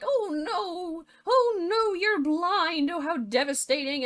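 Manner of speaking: high-pitched hammed-up lament, easing down; mock distress. Lettuce finding the prince blind, mock distress. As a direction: wail it as an overdramatic fairy-tale heroine.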